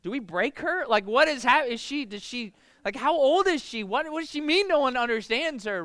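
high, loud, baffled rambling; a dad thrown by his daughter's drama; bewildered parent. Retold or imitated. Father asking his wife Angela what went wrong, baffled. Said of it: "I'm like".

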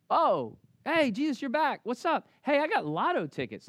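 high, breezy mock greeting; casual mock Thomas. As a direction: play Thomas as a careless buddy. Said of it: "Like, he didn't say that".

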